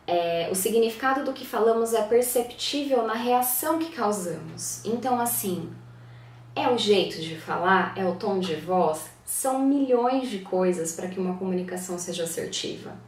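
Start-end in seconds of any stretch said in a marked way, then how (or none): none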